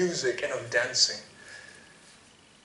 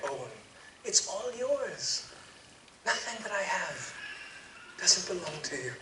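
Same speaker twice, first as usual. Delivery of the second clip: soft, gentle, pleading tenderness; father calming his resentful older son; tender; 4 semitones higher, 4 dB quieter than usual. Acted. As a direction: soothe your hurt son quietly. Father gently answers angry son.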